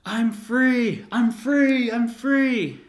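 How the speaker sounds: high, loud shout, "three" pronounced "free"; excited toddler screaming. Retold or imitated. Three-year-old running around the basement, screaming out. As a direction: yell it like a little kid, saying "free" for "three". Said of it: "I'm screaming out".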